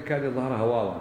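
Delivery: ordinary talk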